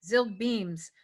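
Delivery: usual speaking voice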